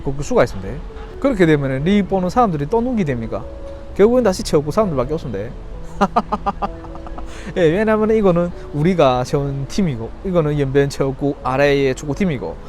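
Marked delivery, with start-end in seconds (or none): 5.5-7.5 breathy chuckle. Real, not acted. Scoffing at officials.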